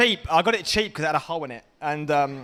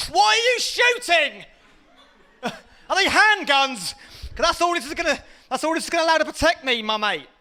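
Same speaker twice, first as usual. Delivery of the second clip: high, loud panicked yelling under fire; a frightened soldier screaming at the shooters; 10 semitones higher, 4 dB louder than usual. Acted. Comic imagined soldier in battle, high panicked yell.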